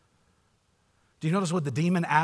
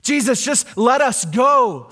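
high, louder demon pleading; 10 semitones higher, 9 dB louder, slower than usual. Retold or imitated. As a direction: beg Jesus to stay away.

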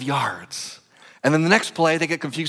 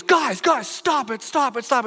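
raised, urgent coach call; coach yelling; 11 semitones higher than usual. Retold or imitated. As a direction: coach sprinting down the field to stop the celebration. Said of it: "he goes".

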